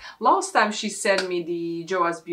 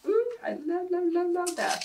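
gushing delight; 12 semitones higher than usual. Real, not acted. Gushing over product.

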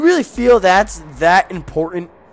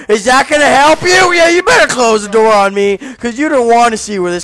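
much higher, louder scolding; mock furious rant; 9 semitones higher, 8 dB louder than usual. Gamer mock berating a zombie over its belly ring, shouting. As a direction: pretend to chew out the zombie.

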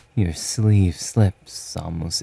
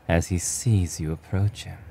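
ordinary delivery, nothing marked

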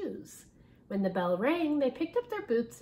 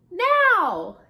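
high, loud shout of Now; yell; 9 semitones higher, 13 dB louder than usual. A narrator's reading. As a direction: bellow the signal to pull. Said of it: "yelled Missus Toggle".

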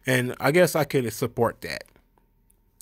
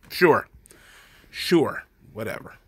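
sarcastic, dismissive sure, trailing off; slower than usual. Real, not acted. Vlogger brushing off a plea to vote, sarcastic. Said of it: "dismissing the quote".

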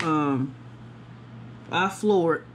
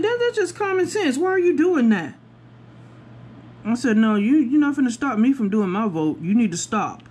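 sassy scolding; 7 semitones higher than usual. Retold or imitated. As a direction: put her in her place. Continuing to scold the worker.

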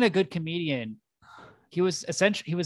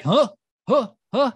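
startled huffs; 8 semitones higher, 6 dB louder than usual. Acted. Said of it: "he's like".

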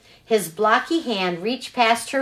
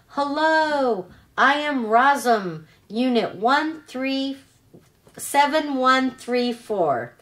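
robot voice; 5 semitones higher than usual. Acted. Robot reboots and introduces herself.

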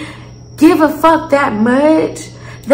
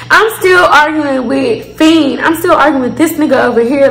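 ordinary delivery

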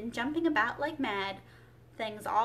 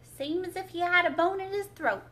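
higher, louder storybook character line; lightly voiced Gryphon; 7 semitones higher, 6 dB louder than usual. A narrator's reading. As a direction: pipe up louder as the creature. Gryphon's line read in acted voice.

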